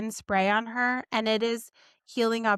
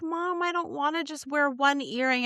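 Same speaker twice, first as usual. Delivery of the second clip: higher, lightly voiced pouty girl; mother lightly mimics her daughter refusing one earring; imitating whiny daughter; 5 semitones higher than usual. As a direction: play the kid protesting, a touch whiny.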